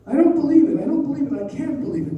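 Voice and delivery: high, loud protest easing off; acted outburst of disbelief; agitated disbelief. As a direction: refuse the news, agitated and high-pitched. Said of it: "Dolly's agitation had an effect".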